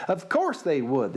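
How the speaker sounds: ordinary delivery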